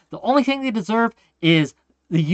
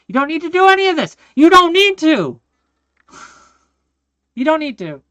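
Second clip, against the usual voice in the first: higher, louder, fast plea; pleading shout; 10 semitones higher, 7 dB louder than usual. Real, not acted. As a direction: beg them to stop, loud and urgent. Pleading with opponents.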